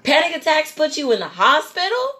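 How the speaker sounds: high, rising disbelieving question; incredulous. Retold or imitated. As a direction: ask it in loud-ish comic disbelief. Acts out incredulous reply to doctor.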